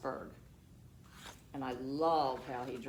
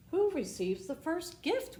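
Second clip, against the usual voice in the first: high, loud eager question; eager children; 13 semitones higher, 6 dB louder than usual. Acted. Children asking conductor, eager high question. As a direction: ask it bursting with curiosity. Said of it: "we all asked".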